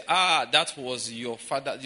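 slow, loud mocking quote of them; slow, loud put-on voice, poking fun. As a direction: drag it out loudly, mocking the claim. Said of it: "they say".

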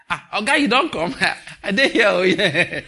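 hammed-up laughing salute; playful greeting of a big man. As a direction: hail the boss with exaggerated cheer. Acting jokey greeting of husband.

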